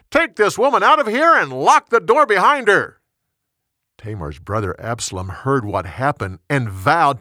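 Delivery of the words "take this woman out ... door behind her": loud, high-pitched command; shouted order. A narrator's reading. Amnon orders his servants to throw Tamar out, shouting. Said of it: "he shouted to his servants".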